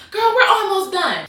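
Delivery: high, loud dismissive imitation; high-pitched mock of the roommate. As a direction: brush her off in a high voice. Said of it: "She was like".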